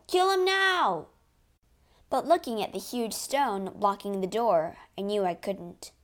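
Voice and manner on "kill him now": higher, more desperate shout of kill him now; panicked pleading shout; frantic cry. Acted. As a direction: scream it in panic. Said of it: "Some of my men cried".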